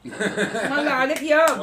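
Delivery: slow delighted laughing praise. Real, not acted. Mother laughing at father's rhyme.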